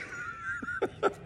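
high burst of real laughter. Real, not acted. Preacher laughing at his own workplace story. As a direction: break up laughing at the memory.

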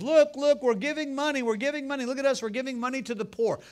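high, loud mocking crier calling out; hammed-up Pharisee crier boasting; mock street crier shouting his good deeds; show-off crier. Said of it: "they have criers".

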